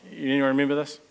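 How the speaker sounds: ordinary talk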